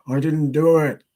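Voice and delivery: high, loud film-boxer impression; Sylvester Stallone. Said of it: "I just think of Sylvester Stallone".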